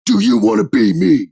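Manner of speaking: hammed-up wrestling promo yell; mock yell. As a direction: bark it at him like a furious champion. Roman yelling at Sami.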